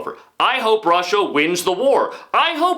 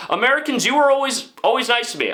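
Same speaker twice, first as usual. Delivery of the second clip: ordinary talk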